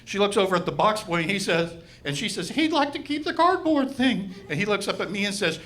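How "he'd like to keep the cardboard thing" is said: high, slightly puzzled relay; relaying cashier. Retold or imitated. Cashier telling the box boy, high puzzled relay. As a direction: pass the odd request along, pitched up.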